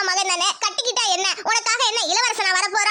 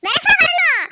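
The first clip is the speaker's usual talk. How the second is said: higher and louder, hurry her along; mother shouting to her dawdling daughter to hurry; sharp call; 7 semitones higher, 6 dB louder, slower than usual. Acted. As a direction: snap at her to be quick.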